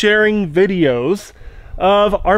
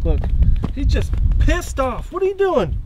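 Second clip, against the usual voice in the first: higher, playful taunting of the angry duck; teasing challenge; 7 semitones higher than usual. Real, not acted. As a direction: goad him on like a sparring partner.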